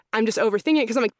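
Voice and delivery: normal talking voice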